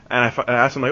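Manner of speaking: ordinary delivery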